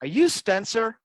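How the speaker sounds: lightly done Irish brogue, rising question. Retold or imitated. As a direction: imitate a friend's Irish brogue asking a question. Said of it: "in his Baroque accent".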